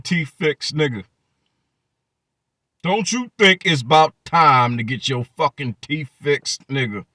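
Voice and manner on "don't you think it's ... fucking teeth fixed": ordinary talk, nothing marked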